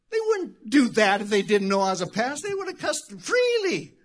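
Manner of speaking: loud, high-pitched preaching climax ending in a shout; full-force preaching. Acted. Pastor preaching about cussing around him, shouting. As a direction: drive it home to the congregation.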